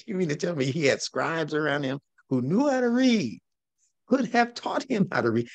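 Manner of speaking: high-pitched, laughing disbelief; incredulous. Real, not acted. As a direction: challenge them, voice climbing high in disbelief. Mocking disbelief at the accepted story.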